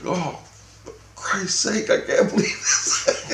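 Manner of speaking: high, slow, half-laughing exasperation; Jesus exasperated. Retold or imitated. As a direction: sigh it out in disbelief. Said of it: "he's like".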